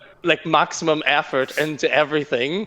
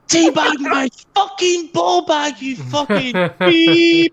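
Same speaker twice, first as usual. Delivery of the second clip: slow, high, much louder enraged yell; raging scream; 6 dB louder, slower than usual. Retold or imitated. Housemate walks in on gamer screaming, furious yell. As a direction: bellow it with full fury. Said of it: "to hear me screaming".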